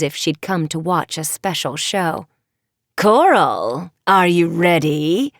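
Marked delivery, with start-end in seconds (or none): 3.0-5.3 a big sea witch calling her daughter. Acted. Sea witch calling Coral, slow and commanding.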